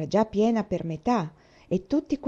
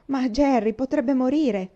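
higher, louder, slow fear; anguished; 6 semitones higher, 5 dB louder, slower than usual. Acted. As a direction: plead in real fright. Sister anguished.

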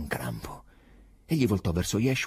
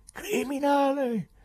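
high, slow, rising accusation; louder shout; 5 dB louder, slower than usual. Acted. Pilate denouncing the prisoner, high slow shout. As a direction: push the word out harder. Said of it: "Alzò ancora la voce".

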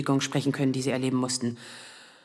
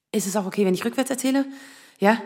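ordinary talk, nothing marked

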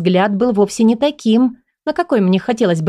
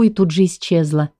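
ordinary delivery, nothing marked

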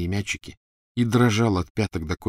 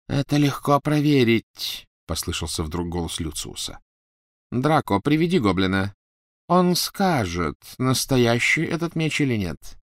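cold aristocrat; 5 semitones higher than usual. A narrator's reading. Lucius voiced, cold.

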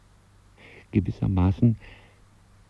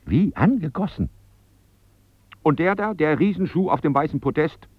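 indignant demand; 7 semitones higher, 3 dB louder than usual. Acted. Indignant customer demands display shoe.

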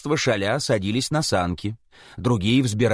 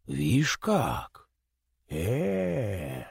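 dumbfounded Chub; 2 semitones lower, 6 dB quieter, much slower than usual. Acted. Chub staring at the head, dumbfounded drawl. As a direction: trail off, lost for words.

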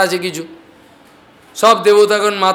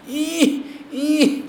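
mock straining grunt; 9 semitones higher, 6 dB quieter than usual. Straining to lift mountain.

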